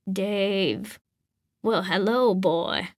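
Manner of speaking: put-on gruff old man warming up; suddenly friendly, slow old voice; welcoming old man. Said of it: "tone of voice changed abruptly ... to welcoming".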